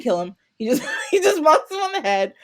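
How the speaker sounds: real laughter breaking into the line; laughing. Real, not acted. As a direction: crack up while telling it. Laughs while telling the bonk.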